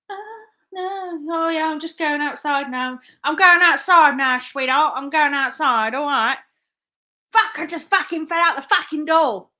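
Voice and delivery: hammed-up soap cliffhanger acting, high and loud; EastEnders character. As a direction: play an EastEnders scene, building to loud exclamations. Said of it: "act out an EastEnders ending".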